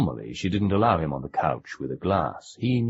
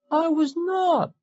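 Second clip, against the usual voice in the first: put-on high, slow, wavering boy's voice; tearful child; slower than usual. A narrator's reading. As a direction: protest through tears like a small boy. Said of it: "voice wavered tearfully".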